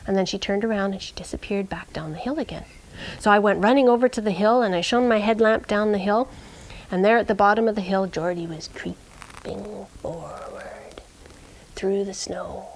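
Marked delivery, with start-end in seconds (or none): 0.9-2.7 quick whisper. A narrator's reading. Narrator telling the dog's return, whispered. Suspense in dark woods. 8.1-12.7 slow, drawn-out whisper. A narrator's reading. Narrator telling the dog creeping forward, slow whisper.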